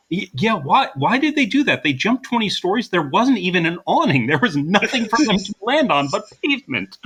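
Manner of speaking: baffled, high-pitched mock protest; retold incredulity. Film fan mimicking baffled viewers after the failed jump, incredulous. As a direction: imitate an audience in disbelief, pitched well up. Acting out everyone's baffled reaction.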